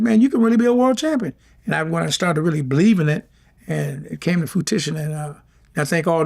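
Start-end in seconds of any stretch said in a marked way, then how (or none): 0.0-1.3 high-pitched, urging quote; retold pep talk in a raised voice; imitated encouragement. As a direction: hype up a youngster, pitched well above normal. Retelling older mentors urging him on.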